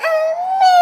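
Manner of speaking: high tearful wail for mother; crying call. Acted. Sick little girl calling her mother, high crying wail. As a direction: cry out for mummy like a small child.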